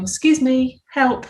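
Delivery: high-pitched, polite little call for help; body's plea. Acted. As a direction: lightly voice a body politely asking for attention. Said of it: "that is your body going".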